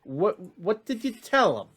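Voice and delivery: high, worried voice over a sending stone; high-voiced, hesitant reproach; high-pitched voice. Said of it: "hear that high-pitched voice say".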